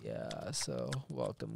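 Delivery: low, slow, soft seductive voice; sexy voice. Pretend. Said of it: "I'm gonna use my sexy voice".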